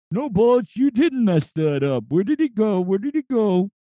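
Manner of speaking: reassuring vulture, pitched high. Retold or imitated. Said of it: "the impersonation".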